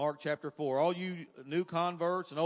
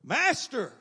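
loud, high shout of alarm; acted shout, pitched way up; emphatic desperate cry; 10 semitones higher, 9 dB louder than usual. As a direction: cry out for help over a storm. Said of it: "even a little more emphatic than that".

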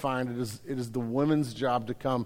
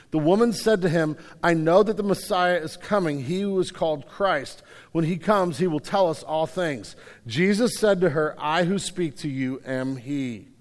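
loud, measured Bible reading from the pulpit; scripture reading; 3 semitones higher, 5 dB louder than usual. Acted. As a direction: read the Gospel passage with weight, like a preacher at the lectern. Reads John 4 aloud.

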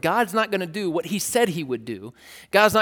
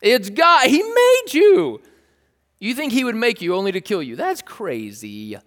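loud, high mock disbelief, dropping on the last remark; hammed-up persuasive protest; incredulous arguing; 7 semitones higher, 7 dB louder than usual. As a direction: argue like the doubt is absurd. Acting out the serpent's argument with Eve.

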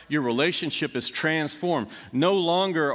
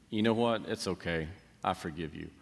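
forgiving sister; 6 semitones lower, 7 dB quieter than usual. Acted. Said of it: "you hear the sister say".

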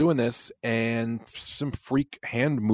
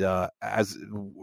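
ordinary delivery

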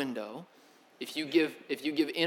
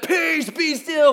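high, slow, ringing command; commanding call; 11 semitones higher, 8 dB louder, much slower than usual. Retold or imitated. Jesus stilling the storm, slow and commanding. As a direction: stretch it out over the crashing waves. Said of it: "and Jesus said".

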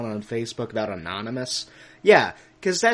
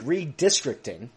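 ordinary talk, nothing marked

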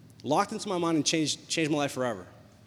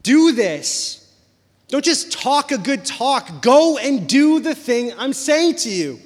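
louder and higher, slowing to punch each command; fiery preaching; 5 semitones higher, 7 dB louder than usual. Acted. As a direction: preach it hard, urging the church to act. Preaching builds to full force.

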